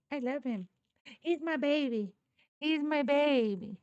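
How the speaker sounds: high-pitched cooing over a pet; doting coo. Real, not acted. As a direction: coo lovingly, voice lifted high. Doting on the dog.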